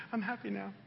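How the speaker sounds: high, small, tearful mock voice; imitated crying child. Preacher imitating his crying daughter, tearful little voice. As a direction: mimic a crying kid softly insisting through tears. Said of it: "she's crying and said".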